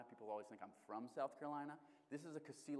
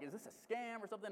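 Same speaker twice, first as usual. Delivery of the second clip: higher, incredulous retold question; disbelieving, voiced up high; mock disbelief; 7 semitones higher than usual.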